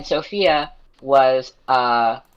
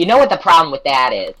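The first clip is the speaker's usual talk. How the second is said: louder imitation of an older-sounding roommate; mock forty-year-old; 3 semitones higher, 8 dB louder than usual. Roommate suddenly starts going off, mock middle-aged griping. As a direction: complain loudly like someone twice your age. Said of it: "speaking like a forty-year-old".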